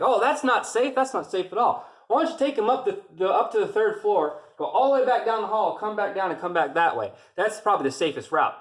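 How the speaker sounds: high, mock-concerned sarcasm; sarcastic orders. Drill sergeant mocking recruits carrying him, high sarcastic orders. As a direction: send them the long way with fake concern. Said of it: "He's like".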